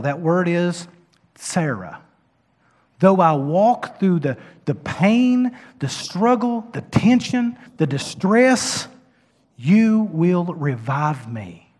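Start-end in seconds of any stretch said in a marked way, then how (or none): 3.0-11.7 high, rising plea slowing at the end; impassioned scripture. Real, not acted. Preacher voicing the psalmist's plea, high and slow. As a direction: pray the psalm with strain.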